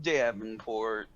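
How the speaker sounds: low, soft, slow name; flat mumbled reply; deadpan mutter. Acted. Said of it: "And he's just like".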